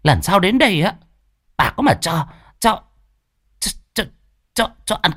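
high, sputtering angry cursing; fuming curse. Acted. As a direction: curse him, stammering with rage. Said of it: "khuôn mặt hầm hầm".

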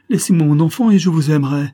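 loud, high-pitched pleading; desperate cry. A narrator's reading. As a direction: bargain desperately at full voice. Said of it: "s'écria-t-elle".

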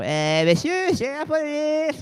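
hesitant and unsure, a feeble "police"; timid police call. Shown as a demonstration. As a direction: say it like a meek cop who lacks a voice. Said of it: "On dit pas".

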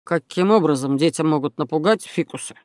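mildly baffled question; puzzled surprise. A narrator's reading. Sergeant to complaining neighbour, mildly surprised. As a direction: ask it in understated disbelief. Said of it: "удивился сержант Штраус".

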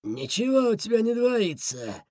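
rough-voiced bandit setting him straight; hoarse bark. A narrator's reading. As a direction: growl it hoarsely. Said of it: "рявкнул Бобр-Боб. Голос у него был хриплый".

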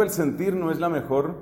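normal talking voice

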